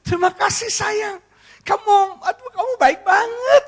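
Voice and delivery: very high, weepy thanks; hammed-up crying wife, overcome; mock tearful. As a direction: gush through pretend tears. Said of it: "Dia nangis".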